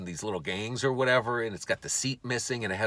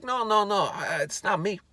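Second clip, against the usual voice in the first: high-pitched mock denial; protesting intruder; 10 semitones higher than usual. Accused man denying car break-ins, high acted protest. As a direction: plead innocence in a high put-on voice.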